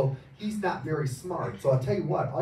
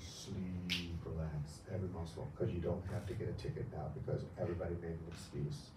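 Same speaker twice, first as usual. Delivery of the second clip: hypnotic induction; 6 semitones lower, 6 dB quieter than usual. Acted. Hypnotist putting volunteers under, soft and low. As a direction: lull them deeper.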